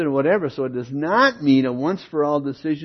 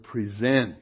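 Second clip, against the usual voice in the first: usual speaking voice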